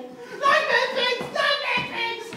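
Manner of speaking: slow, high, loud terrified wail; fearful cry. Acted. Boy cries again about the pigs, terrified. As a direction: drag out each cry in terror. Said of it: "Ralph cried again".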